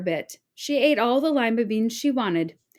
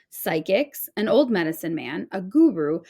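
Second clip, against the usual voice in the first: ordinary delivery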